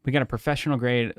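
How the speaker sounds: ordinary talk, nothing marked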